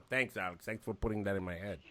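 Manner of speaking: mock resentful thanks; grudging, sulky thank-you; resentful compliance. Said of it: "resentful voice now".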